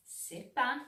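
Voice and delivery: ordinary delivery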